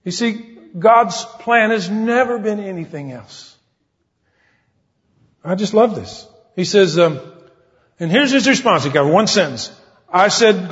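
high, loud preaching, slowing on key lines; full-force sermon delivery; fervent preaching. Acted. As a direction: drive the point home from the pulpit. Preaching with rising fervor.

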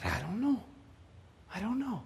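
very soft, almost to oneself; hushed bewilderment. Retold or imitated. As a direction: imitate a dazed person answering under the breath. Voices the bewildered healed person.

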